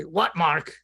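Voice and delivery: lightly voiced Brooklyn grouch; Brooklyn accent. Dead mentor Larry answers when called, lightly Brooklyn accent. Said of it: "in his Brooklyn accent".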